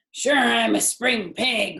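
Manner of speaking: jolly, boastful hog; big hearty voice. A narrator's reading. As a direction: boom it out like a proud big pig. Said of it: "in a big, hearty voice".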